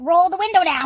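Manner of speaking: imitating an exasperated official's shout; retold yell. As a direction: yell it as the impatient border officer. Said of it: "Like, don't yell at me".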